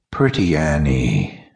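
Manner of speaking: low eerie whisper; dark whisper behind you. A narrator's reading. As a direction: whisper it over the victim. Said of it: "the shadow whispered".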